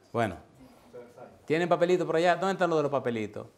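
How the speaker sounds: ordinary talk, nothing marked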